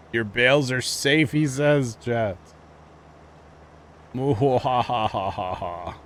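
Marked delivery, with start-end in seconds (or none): 4.1-6.0 maniacal villain cackle; evil genius laugh. Pretend. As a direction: cackle like a cartoon mastermind. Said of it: "Insert evil genius laugh".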